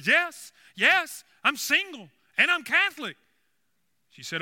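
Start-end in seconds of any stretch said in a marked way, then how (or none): 0.0-3.1 loud, high, excited cabbie; eager yes. Acted. Cabbie eagerly assures the nun he qualifies, excited. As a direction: blurt it out high and eager, hammed up.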